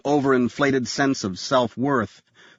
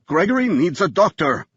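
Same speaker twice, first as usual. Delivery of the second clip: voice breaking; 6 semitones higher than usual. Acted. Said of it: "His voice broke".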